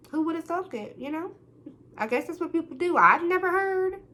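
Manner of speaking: high, sarcastic disbelief. Real, not acted. Sarcastic aside about others' behaviour.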